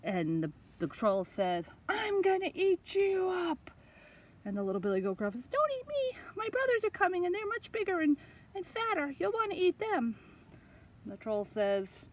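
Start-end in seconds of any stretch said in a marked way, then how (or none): 1.9-3.7 high, slow troll threat; storybook troll threatening; menacing troll. Acted. Troll threatens the little goat. 5.5-10.2 very high, tiny voice; high-pitched little goat begging; pleading little goat. Acted.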